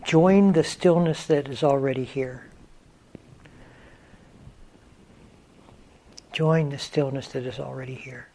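unhurried meditation guidance with long pauses; guided meditation. Real, not acted. Teacher leading students into stillness, guided meditation voice. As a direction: guide them gently into stillness, leaving space between phrases.

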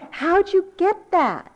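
high, slow, laughing disbelief; mock amazement. Grandmother teasing granddaughter about her attitude, mock amazement.

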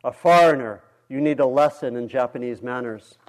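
loud taunting bellow; roaring drunk. Acted. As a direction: shout the insult down the train car. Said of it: "he roared".

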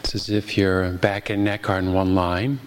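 ordinary delivery

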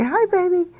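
very high greeting to an infant; high-pitched baby-talk hello. Shown as a demonstration. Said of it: "nobody talks to a baby in this tone of voice".

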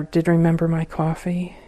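ordinary talk, nothing marked

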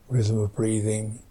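soft, hushed meditation guidance with long pauses; guided meditation. Acted. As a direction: guide them gently into the body, phrase by phrase, leaving space. Leads the silent meditation practice.

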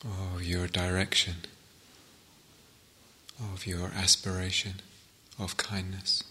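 calm meditation guide with long pauses between phrases; guided meditation. Real, not acted. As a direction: settle listeners into stillness, phrase by unhurried phrase.

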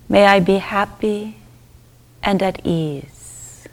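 normal talking voice

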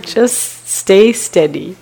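very slow, measured teacher's voice, words drawn out; quoting the teacher. Retold or imitated. Translator passing on the teacher's advice to the yogis, very slow and measured. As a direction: give the instruction slowly and steadily, like the teacher would. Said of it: "He said".

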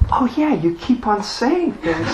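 high-pitched, wondering recognition; dawning realization. Retold or imitated. Student to teacher as insight dawns, high wonder.

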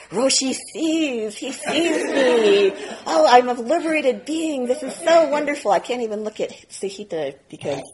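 mock grandiosity. Student lampooning his own spiritual hubris, gushing and laughing. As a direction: gush over your own enlightenment, laughing. Mock inflated enlightened inner voice.

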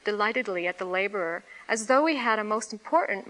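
usual speaking voice

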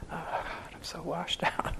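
a drained, breathy whisper of exhaustion; imitating a worn-out yogi; washed-out whisper. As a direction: sigh it out like you're spent. Exhausted meditator after a long sit.

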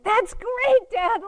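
put-on very high, loud, sarcastic kid cheer. Kid sarcastically praising Dad's crash, high and loud. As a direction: cheer him on like a cheeky child. Kid egging on raging father.

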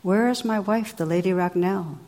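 usual speaking voice

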